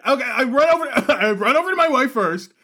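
very high, flustered rush of alarm; comic panic. Acted. Player hurrying to a dying wife, high comic panic. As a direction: announce it in high, playful panic.

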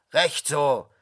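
loud, firm agreement; calling out. A narrator's reading. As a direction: call it out with authority. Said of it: "rief der Verwalter".

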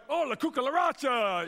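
high, loud joke Spanish phrase; mock Spanish exclamation. Stranger startled by the hug, mock Spanish blurt, loud and high. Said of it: "the guy's like".

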